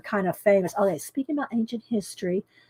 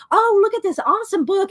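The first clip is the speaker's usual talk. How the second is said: high-pitched gushing quote; mock excitement; 9 semitones higher, 4 dB louder than usual. Buyer spotting a book online, mock excited. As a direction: play the thrilled shopper, pitched well up.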